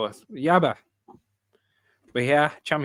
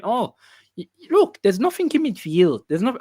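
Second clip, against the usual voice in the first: a hint of a Scouse pundit, pitched up; lightly imitating Jamie Carragher in Scouse; Scouse accent; 10 semitones higher, 5 dB louder than usual. Carragher mocked on a clip, light Scouse. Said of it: "I can't do a Scouse accent".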